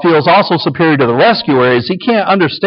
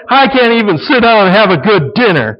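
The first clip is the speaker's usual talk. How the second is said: mock whiny husband, higher; complaining husband; 7 semitones higher than usual. Husband sulking over dinner to wife, mock whiny. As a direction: sulk about dinner, hammed up. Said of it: "husband playing victim".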